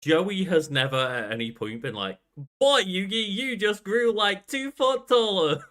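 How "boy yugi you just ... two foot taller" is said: high, loud, over-the-top buddy voice; exaggerated mock voice. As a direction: ham it up like a brash sidekick. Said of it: "trying to exaggerate my voice in a general way".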